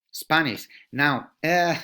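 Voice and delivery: ordinary delivery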